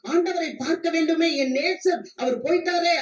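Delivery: high, urgent preacher's lament; acted anxious longing.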